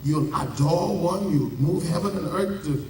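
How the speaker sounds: ordinary talk, nothing marked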